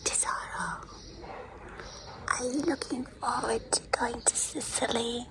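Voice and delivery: voiceless whisper into the phone. Real, not acted. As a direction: whisper to someone on a call. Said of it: "I have to whisper".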